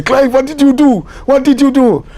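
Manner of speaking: a worried guide fearing trouble; panicky. Retold or imitated. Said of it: "the guy say".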